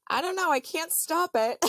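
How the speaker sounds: high, loud mock helplessness; retold helpless protest. Wife telling husband she can't stop it, high loud protest. Acted panic at water breaking.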